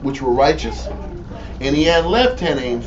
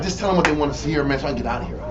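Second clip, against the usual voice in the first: muttered aside; 8 dB quieter, much faster than usual. Retold or imitated. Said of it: "the flesh was like".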